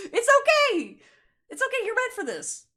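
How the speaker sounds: high, loud "it's okay"; loud reassurance. Real, not acted. Reader calling out to a doubting viewer, high loud reassurance.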